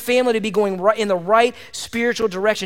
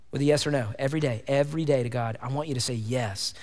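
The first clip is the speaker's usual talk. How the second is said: much softer, intimate pastoral plea; soft earnest appeal; 6 semitones lower, 8 dB quieter than usual. Acted. Pastor urging church to say yes to God, softly. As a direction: lean in and plead gently.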